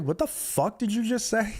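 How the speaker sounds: retold indignant challenge. Confronting the fan who insulted Craw, retold indignation. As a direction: re-enact confronting a rude stranger. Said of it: "I was so mad".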